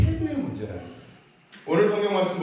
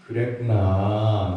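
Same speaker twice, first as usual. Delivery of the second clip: gentle empathy; 5 semitones lower, 5 dB louder, much slower than usual. Shown as a demonstration. Pastor modeling tender words to a child. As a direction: draw it out tenderly like a parent. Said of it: "요거 말을 한 거예요".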